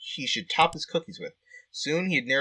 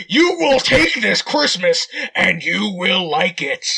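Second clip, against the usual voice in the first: slow, loud, menacing Santa voice; aggressive Santa; 2 semitones higher, 6 dB louder, slower than usual. Acted. Player voicing Kyle forcing cheer on strangers, menacing. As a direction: force the cheer on them, slowly. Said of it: "spread Christmas cheer even more aggressively".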